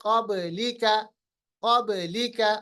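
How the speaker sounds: क़ब्लिका with a bounce after ब; qalqalah demo. Shown as a demonstration. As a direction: echo the ब in क़ब्लिका. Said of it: "क़ब नहीं". (क़ब्लिका में बा का क़लक़ला)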